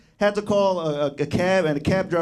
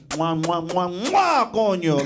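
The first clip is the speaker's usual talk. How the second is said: aggressive kisses; much slower than usual. Retold or imitated. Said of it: "He's like".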